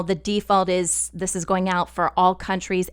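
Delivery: ordinary delivery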